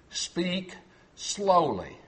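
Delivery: ordinary talk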